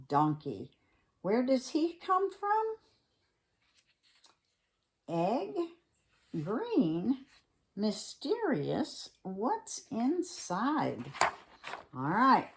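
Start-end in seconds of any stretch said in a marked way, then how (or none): 1.2-11.1 slow, hushed mystery voice; mysterious. Acted. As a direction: tease the mystery slowly. Mysterious egg introduction.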